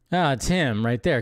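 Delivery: ordinary talk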